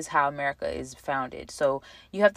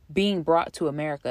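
ordinary delivery

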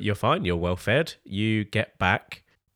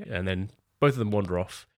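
ordinary talk

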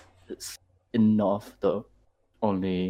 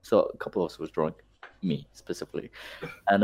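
ordinary delivery

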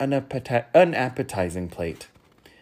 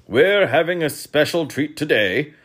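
higher, loud royal announcement; king's voice; 7 semitones higher, 8 dB louder than usual. A narrator's reading. King announcing dessert to his guests, high and loud. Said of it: "said the king".

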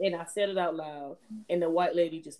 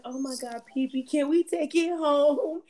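put-on begging child; 6 semitones higher, 6 dB louder than usual. Scene: her friend's son begging to take the dog home, high and loud. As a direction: beg like an excited little boy.

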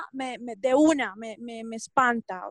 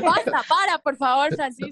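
giggly "stop, please", louder; laughing protest; 3 semitones higher, 4 dB louder than usual. Real, not acted. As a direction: laugh while begging him to stop. Embarrassed by compliment, laughing.

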